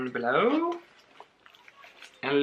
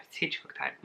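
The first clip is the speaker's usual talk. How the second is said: whispered aside; 3 semitones lower, 12 dB quieter, much faster than usual. Pretend.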